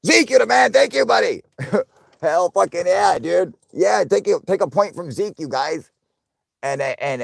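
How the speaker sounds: very high, loud, excited cheering; hyped thanks. Real, not acted. Streamer cheering donor Zeke, shouted hyped thanks. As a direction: holler it like a stadium crowd.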